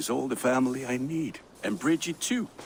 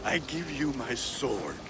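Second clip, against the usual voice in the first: ordinary talk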